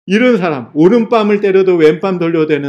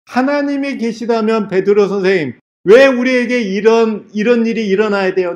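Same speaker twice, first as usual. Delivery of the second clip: retold desperate outcry, pitched up and stretched; anguished wailing protest; 5 semitones higher than usual. Jailed woman cries out to Peter, imitated anguished wail. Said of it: "하고 막 울부짖어요".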